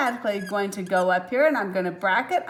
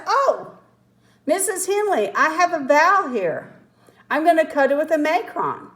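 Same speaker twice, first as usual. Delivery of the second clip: higher-pitched pupil addressing the teacher; acting a pupil; 5 semitones higher than usual. Teacher plays pupil telling her about a vowel, higher-pitched. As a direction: play a pupil piping up in a higher voice. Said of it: "I look at this and I say".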